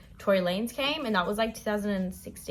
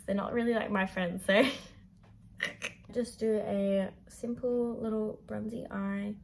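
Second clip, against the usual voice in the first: ordinary talk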